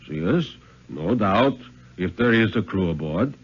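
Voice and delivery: low, sober reply; a hint of a grave head keeper; sober chief. Head keeper answers Gleo soberly, low and grave. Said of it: "nodded soberly and said".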